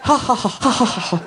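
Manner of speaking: high, staccato mock laughter; imitated laugh. Imitating the host realizing it was a put-on, mock laughing. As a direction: imitate a talk show host cracking up. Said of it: "he starts laughing".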